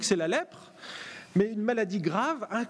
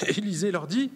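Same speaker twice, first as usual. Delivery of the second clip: normal talking voice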